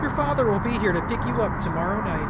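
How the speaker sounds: put-on firm but pleading, a mother locking her child away; stern mother. Voices Mother figure reading game text.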